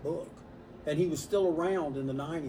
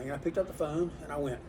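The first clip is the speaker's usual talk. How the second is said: usual speaking voice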